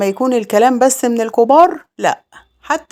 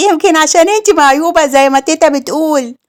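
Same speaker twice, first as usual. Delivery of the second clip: put-on high, playful little boy voice, a touch louder; teasing child; 7 semitones higher, 3 dB louder than usual. Little boy repeating grandma's insult, high and playful. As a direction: toss it off like a careless kid running away. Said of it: "وهو بيضحك ويجري".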